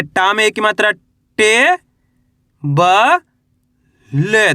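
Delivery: टे, ब, ले each held, with long pauses between; drawn-out teacher's spelling of टे - ब - ले; letter-by-letter spelling. Shown as a demonstration. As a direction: sound out टे, then ब, then ले, stretching each. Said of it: "एक अक्षर का उच्चारण करके".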